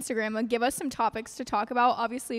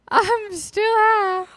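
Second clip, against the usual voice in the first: dazed drawl; 9 semitones higher, 8 dB louder, much slower than usual. Retold or imitated. Quoting herself waking up high.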